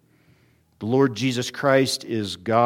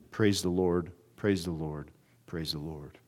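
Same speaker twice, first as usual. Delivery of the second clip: each praise softer, fading to a hush; 5 semitones lower, 7 dB quieter than usual. Real, not acted. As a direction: let the praise die away to almost nothing. Repeated praise fading away.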